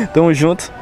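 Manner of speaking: ordinary talk, nothing marked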